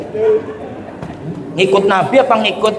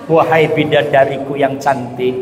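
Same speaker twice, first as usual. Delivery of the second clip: a touch of flattery; 8 semitones lower than usual. Acted. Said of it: "Suaminya muji istrinya".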